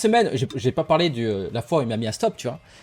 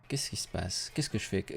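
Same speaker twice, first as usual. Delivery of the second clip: quiet inner panic; 5 semitones lower, 13 dB quieter than usual. Retold or imitated. Inner panic, thinking to himself.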